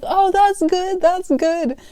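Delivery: high imitated call-back; calling across store. Customer replying across the store, high imitated call. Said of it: "yelling it, like, across the store".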